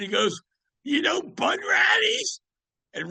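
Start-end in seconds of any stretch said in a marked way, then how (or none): 0.8-2.4 very high, loud, slow near-shout. Retold or imitated. [name] asks the bartender about the club, shouting high with excitement. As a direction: call it out high and thrilled, stretching the name. [name] voiced in retold story.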